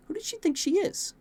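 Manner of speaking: put-on a sulky teen's squeaky outburst; indignant huff. A narrator's reading. Prince stomping down the hall, petulant huff. Mini temper tantrum.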